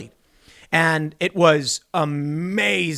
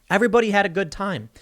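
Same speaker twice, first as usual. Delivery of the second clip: normal talking voice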